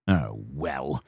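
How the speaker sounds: low, soft sulky sigh of a reply. A narrator's reading. Sir Handel sulkily giving in to the fireman, low and soft. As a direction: grudgingly give in. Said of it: "said Sir Handel sulkily".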